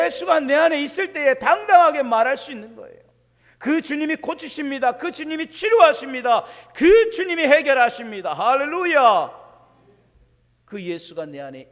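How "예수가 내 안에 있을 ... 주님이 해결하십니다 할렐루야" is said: loud, high proclamation; a climactic declaration to the congregation; fervent preaching. Real, not acted. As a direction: preach it out with full force. Preaching at full force.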